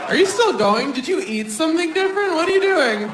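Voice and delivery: mock scolding partner; imitating annoyed girlfriend. Wife scolding partner in scene.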